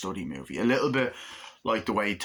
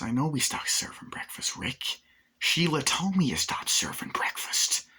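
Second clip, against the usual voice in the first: whisper; 6 semitones higher than usual. Retold or imitated. Michael Douglas mocking first names.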